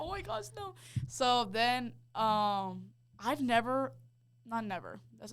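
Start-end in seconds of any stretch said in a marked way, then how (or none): 0.0-0.7 high, softly squeaked mock panic; mock freak-out. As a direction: play a little panic up high. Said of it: "I am freaking out".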